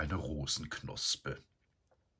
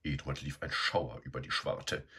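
ordinary delivery, nothing marked

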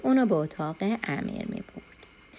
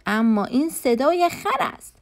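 loud, higher, slow and emphatic; angry; 7 semitones higher, 5 dB louder than usual. A narrator's reading. Boy master kicking the drum he despises, angry. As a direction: drive each word out in a raised, angry voice. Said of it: "امیر عصبانی شد".

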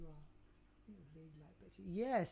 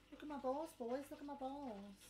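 playful show-off; 6 semitones higher than usual. Acted. Repeated show-off calls.

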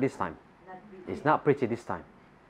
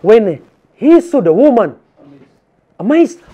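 amazed; 7 semitones higher, 9 dB louder than usual. Acted. Preacher acting John's amazement.

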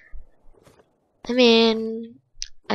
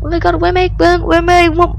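panicked; 9 semitones higher, 5 dB louder, faster than usual. Real, not acted. Lost after escape.

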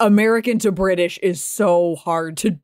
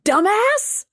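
indignant outburst; 13 semitones higher than usual. Real, not acted. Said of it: "[name]'s never angry".

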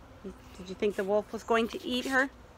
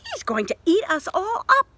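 high, louder alarmed warning; worried Dot; 9 semitones higher, 4 dB louder than usual. Acted. Dot warning her parents, high and alarmed. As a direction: warn them like a jealous big sister.

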